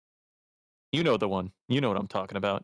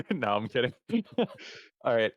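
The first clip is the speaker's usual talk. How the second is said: ordinary talk, nothing marked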